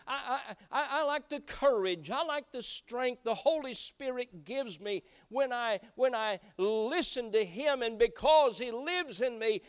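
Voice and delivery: loud, high, laughing joy; bursting with happy gratitude; joyful testimony. Acted.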